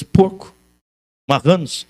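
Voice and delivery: ordinary talk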